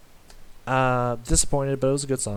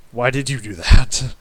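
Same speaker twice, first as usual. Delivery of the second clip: louder, pressing why did you do that; frustrated, louder outburst; exasperated demand; 10 dB louder than usual. Real, not acted. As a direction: push the question hard at them. Exasperated question to the artist.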